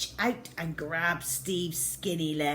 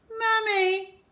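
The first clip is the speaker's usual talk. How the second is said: high frightened whimper; scared child calls for mum; 6 dB louder than usual. Acted. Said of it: "whimpered Steve".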